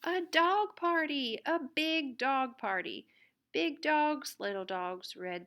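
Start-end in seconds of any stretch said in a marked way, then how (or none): 0.0-4.4 higher, louder big reveal; excited. A narrator's reading. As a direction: announce the party with delight. Reveal of the dog party.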